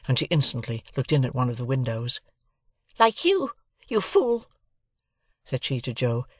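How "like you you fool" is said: high, sharp scolding voice; angry scolding. A narrator's reading. Sister scolds her husband Joe, angry. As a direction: berate him at a high pitch.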